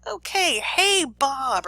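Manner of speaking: high, sing-out hello; breezy acted entrance; mock-casual greeting. Teacher plays Milgram walking in to debrief Bob, high breezy greeting.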